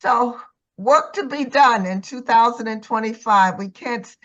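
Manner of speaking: ordinary talk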